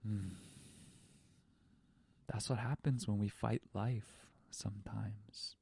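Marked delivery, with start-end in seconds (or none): 2.3-5.5 hushed reflective whisper. Real, not acted. Telling listeners the lesson, hushed whisper. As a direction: let the lesson land in a whisper. Reflective moral after story.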